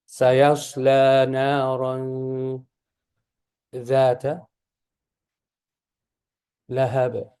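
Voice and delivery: slow, measured tajweed demonstration; teacher modelling drawn-out scripture recital; Quran recitation. Shown as a demonstration. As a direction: recite slowly with held long vowels.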